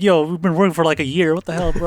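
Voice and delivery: high-pitched mock complaint; retold outrage. Members who worked with Kasuga a year, mock outraged. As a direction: imitate indignant disbelief.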